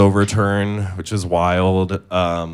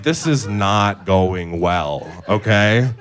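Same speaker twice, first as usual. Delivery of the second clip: slow worried warning; much slower than usual. Retold or imitated. Feeling a bowel movement.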